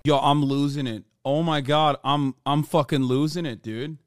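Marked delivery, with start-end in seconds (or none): none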